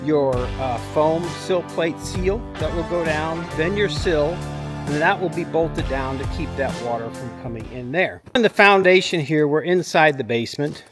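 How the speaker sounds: usual speaking voice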